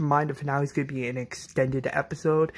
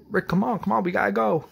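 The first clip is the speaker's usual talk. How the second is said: higher-pitched urgent coaxing; urgent pleading; 7 semitones higher, 3 dB louder, faster than usual. Retold or imitated. Shane urging Rick to leave, urgent and high. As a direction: act out someone pressing to leave.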